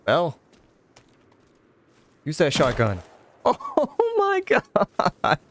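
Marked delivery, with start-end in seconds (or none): 3.4-5.4 high squealing laugh through the words; laughing exclaim. Real, not acted. Player laughing at the shotgun's blast, high laughing exclaim. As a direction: crack up mid-exclamation.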